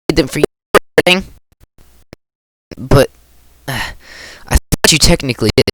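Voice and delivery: put-on flustered girl voice. Flustered tsundere Natsuki.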